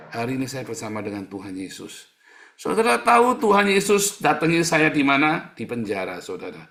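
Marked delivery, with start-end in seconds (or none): 2.6-5.5 high, loud question flung at the crowd; loud rhetorical question. Acted. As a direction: build suspense before the answer. Preacher's big rhetorical question. (pertanyaan retoris yang lantang dan tinggi)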